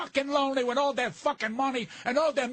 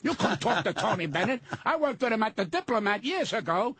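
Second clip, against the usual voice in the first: ordinary delivery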